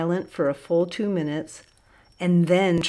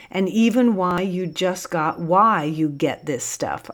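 ordinary delivery

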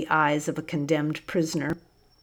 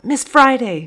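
a much higher, louder call across the room; screaming out; 8 semitones higher, 8 dB louder than usual. A narrator's reading. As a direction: call her over at full voice. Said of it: "screamed out".

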